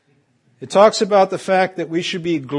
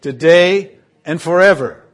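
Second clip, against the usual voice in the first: today and forever called out loud; rising preacher's call, louder at the end; call and response; 8 dB louder than usual. Real, not acted. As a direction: lead the congregation in a loud response. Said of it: "say it with me".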